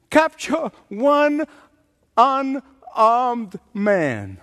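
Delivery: ordinary talk, nothing marked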